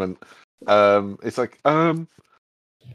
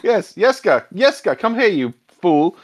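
higher pitched exasperated call; impatient call; 7 semitones higher than usual. Acted. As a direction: call him over, fed up with the fool. Priest calling boy again, annoyed.

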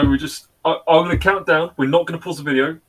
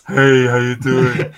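ASMR voice; 4 dB louder, much slower than usual. Pretend. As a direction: greet slowly in the ASMR voice. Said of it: "my special A S M R voice".

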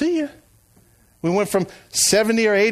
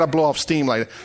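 ordinary talk

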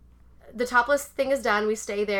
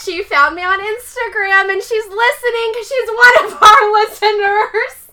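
higher, louder thrilled telling; higher, louder excited gush; excited reveal; 9 semitones higher, 13 dB louder, slower than usual. Real, not acted. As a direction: burst out with the news.

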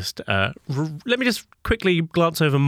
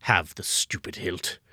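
unhinged villain; 4 semitones lower, 3 dB quieter than usual. Acted. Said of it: "he says".

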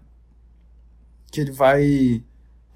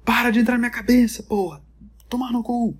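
raised, higher yell at a pest; mock furious rant; angry outburst; 7 semitones higher than usual. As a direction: snap at him to get out of your head. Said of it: "falou assim".

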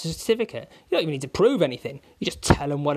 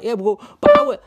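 mock agent voice; 7 semitones higher, 12 dB louder than usual. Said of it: "so they're like".

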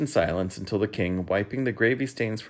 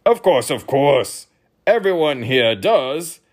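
loud, slow, higher royal voice; grunting king; 6 semitones higher, 10 dB louder, slower than usual. A narrator's reading. King grunting a loud, slow answer to his young guest. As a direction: brush it off loudly and slowly. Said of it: "the king grunted".

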